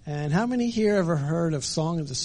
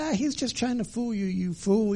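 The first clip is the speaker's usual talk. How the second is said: mock fox yell; 6 semitones higher than usual. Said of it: "Brer Fox is yelling at him".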